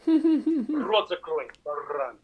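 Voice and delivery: slow, high, gruff mock-father command; Russian father imitation. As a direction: bark it like a stern Russian dad. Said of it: "That's my father says to me".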